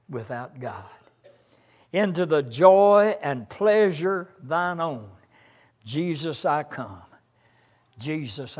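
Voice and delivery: hymn lines intoned, swelling on each opening line; preacher reciting a hymn, rising then hushing; hymn recited. Acted. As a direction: chant the hymn words, falling to a hush on the refrain. Preacher continues hymn verses.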